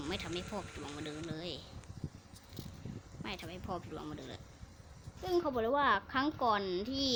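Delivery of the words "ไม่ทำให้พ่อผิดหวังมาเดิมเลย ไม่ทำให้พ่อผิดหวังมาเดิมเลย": low, soft mock-dutiful voice; quiet pretend reply. Game unit answering its dad, quiet pretend reply. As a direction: say it softly and low, playing along.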